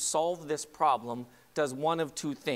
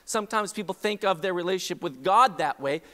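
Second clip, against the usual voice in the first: ordinary talk, nothing marked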